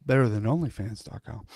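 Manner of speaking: fast, soft sarcastic aside under the breath; muttered aside. Real, not acted. Lawyer mutters a joke aside mid-deposition, fast and soft. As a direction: slip in a quick wisecrack nobody should hear.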